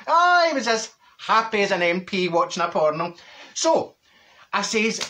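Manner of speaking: ordinary talk, nothing marked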